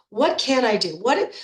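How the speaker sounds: ordinary talk, nothing marked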